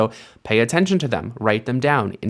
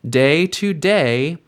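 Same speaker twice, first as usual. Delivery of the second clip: slow emphasis; 5 semitones higher, 3 dB louder, slower than usual. Shown as a demonstration. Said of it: "read it slowly".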